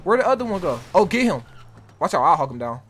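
normal talking voice

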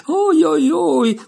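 high, loud little yelps; little cries. A narrator's reading. Alice hit under the chin by the oar, high little yelps. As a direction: squeal it out as the oar hits her chin. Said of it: "la serie di gridolini".

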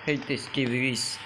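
ordinary talk, nothing marked

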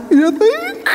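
high-pitched, re-enacted burst of excitement; excited disbelief. Wife replying to husband's baby suggestion, thrilled disbelief. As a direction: squeal it, about to break into laughter.

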